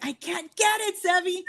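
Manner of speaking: high-pitched mock-helpless plea; retold whine, pitched well up; exasperated whine. Singer pleading with her producer in the studio, whiny.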